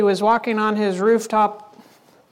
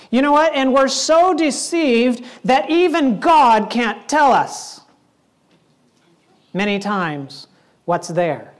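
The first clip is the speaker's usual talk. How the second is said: ordinary delivery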